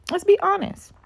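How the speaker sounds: let's be honest in a sudden high squeak; squeaky high mock. Vlogger mocking women who dodge accountability, squeaky high voice.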